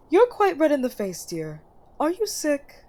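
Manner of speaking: a gently high, doting queen mother; fussing mother. Acted. Mother greeting her son after his meeting, doting. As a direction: greet your son sweetly and a little eager.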